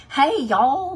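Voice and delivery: a light mock Tennessee drawl on the greeting; Tennessee accent. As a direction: tease the Southern accent, Tennessee-style. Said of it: "everybody in Tennessee talks like this".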